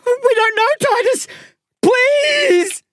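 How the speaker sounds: very high, desperate scream; pleading scream. A narrator's reading. Narrator begs captor Titus as the blade cuts, screaming. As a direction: scream a plea, stretched at the end. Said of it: "I scream".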